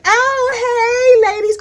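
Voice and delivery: put-on high, gushing girly hello; imitating a bubbly newcomer; mock sweet greeting. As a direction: coo it up high. Serena greeting the ladies.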